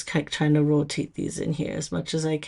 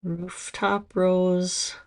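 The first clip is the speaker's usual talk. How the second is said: slow, drawn-out Minnesota vowels; Minnesota accent; 2 semitones higher, much slower than usual. Shown as a demonstration. Reading the polish name to viewers, in a slow Minnesota accent. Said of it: "This is my Minnesota accent".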